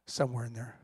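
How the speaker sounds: usual speaking voice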